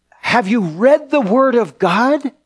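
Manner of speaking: slow, high, amazed call; awestruck proclaiming. Retold or imitated. Excited student to everyone in the hallways, proclaiming in amazement. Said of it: "felt like walking the hallways and saying".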